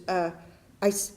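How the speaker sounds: ordinary talk, nothing marked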